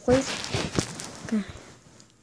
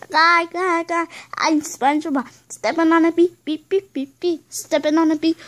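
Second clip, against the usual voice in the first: mocking cartoon imitation, slightly raised; singsong, repetitive mock cartoon voice; SpongeBob; 3 semitones higher than usual. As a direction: mimic an annoying cartoon character, lightly pitched up. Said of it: "He sounds like that".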